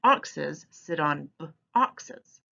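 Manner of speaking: "b-, oxes" slowly with the b held apart from "oxes"; onset split. Shown as a demonstration. Teacher modelling onset blending on "oxes" for children. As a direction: split "oxes" from its first sound b. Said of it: "blend by saying".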